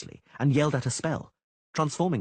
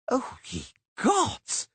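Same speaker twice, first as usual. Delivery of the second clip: high, slow drawn-out wail of awe; admiring wail; much slower than usual. A narrator's reading. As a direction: wail it out in sheer wonder. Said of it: "Dandelion wailed in admiration".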